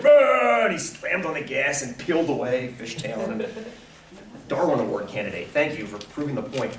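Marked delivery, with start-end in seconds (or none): 0.0-0.6 high, loud mock roar; short hammed-up yell of road rage; imitated angry roar. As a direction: roar like an enraged driver, mockingly. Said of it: "And he's like".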